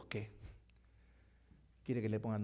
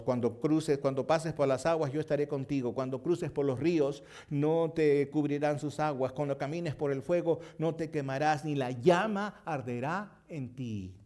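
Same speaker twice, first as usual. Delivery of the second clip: loud, steady proclaiming of the promise; declaiming verse to the congregation; forceful scripture reading; 3 semitones higher, 8 dB louder than usual. Acted. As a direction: proclaim it over the church.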